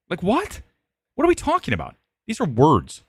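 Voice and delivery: can't believe what he's hearing; incredulous. Real, not acted. Incredulous outburst.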